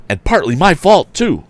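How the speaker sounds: high, loud guilty cry. A narrator's reading. Tom blaming himself as he chases the runaway, high cry. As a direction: call it out while chasing. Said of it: "cried Tom".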